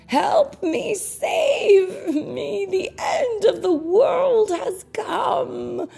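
frantic wailing for help; poor man shrieking to the rabbi for help; shrieked. Acted. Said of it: "he shrieked".

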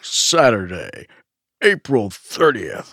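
drawn-out monster truck announcer. Acted. Co-host hamming up the holiday date, monster truck voice. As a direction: stretch each word like a truck rally promo. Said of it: "monster truck voice for a second".

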